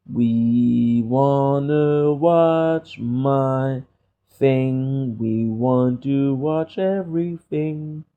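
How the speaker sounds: slow, stretched-out musing; slow drawl. Real, not acted. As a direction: drag each word out lazily. Drawn-out musing while browsing.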